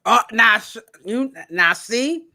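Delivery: usual speaking voice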